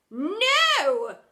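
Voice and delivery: very loud bellowed no. Acted. Headmistress bellowing at the drama teacher, very loud. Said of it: "bellowed the headmistress".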